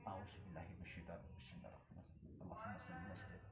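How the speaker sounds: a healer closing the ritual in a whisper; whispered prayer. Real, not acted. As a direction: breathe the words almost silently. Closing prayer under breath.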